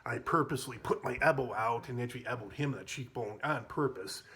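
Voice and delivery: ordinary talk, nothing marked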